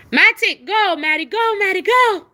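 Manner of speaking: loud, high repeated commands; shouting at the dog to go pee; calling the dog. Real, not acted. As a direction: keep urging the dog out loudly.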